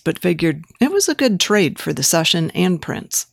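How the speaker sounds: plain reading voice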